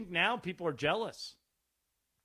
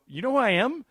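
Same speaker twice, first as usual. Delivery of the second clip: louder, higher surprised question; retold astonishment; 7 semitones higher, 8 dB louder than usual. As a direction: act out amazement at being recognized. Retold surprise at being recognized.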